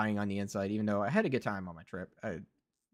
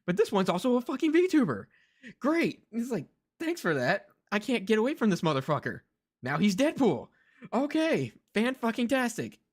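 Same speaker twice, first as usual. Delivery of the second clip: drip sarcasm, voice pitched up; sarcastic exasperation; 10 semitones higher than usual. Real, not acted. Streamer mocks voice actor's new role, sarcastic and high.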